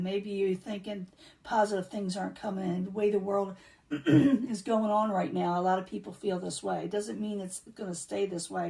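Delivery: ordinary delivery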